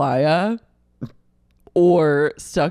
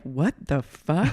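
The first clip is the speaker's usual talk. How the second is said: slow, drawn-out mock shock; retold disbelief; 3 dB quieter than usual. Viewer reacting to the show's scene, drawn-out disbelief. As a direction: stretch each word in appalled disbelief.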